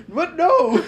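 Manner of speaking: high, loud mock-shocked denial. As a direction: squeak out a startled no. Holly's shocked denial retold.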